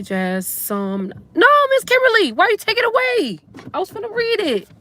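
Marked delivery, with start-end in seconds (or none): 1.3-4.7 high-pitched mock protest, pitched way up; much higher, teasing complaint to a friend; playful whine. Real, not acted. Comment removed before she read it.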